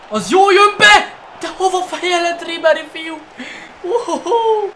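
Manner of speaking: high, loud thrilled outburst; excited shouting. Real, not acted. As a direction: yell at the near goal. Goal chance while gaming.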